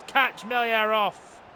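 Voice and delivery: ordinary talk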